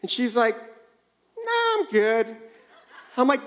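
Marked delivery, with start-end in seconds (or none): 0.5-2.4 high, breezy brush-off; mock casual wife; imitating wife. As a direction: toss it off lightly like she doesn't care. Wife voiced casually dismissive.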